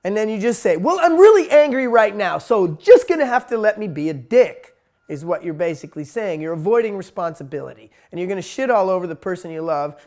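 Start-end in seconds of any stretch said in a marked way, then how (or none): 0.8-4.8 loud, heated self-justifying outburst; angry excuse. Retold or imitated. Partner snapping at a loved one, loud and heated. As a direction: blurt it out like a hothead with a short fuse.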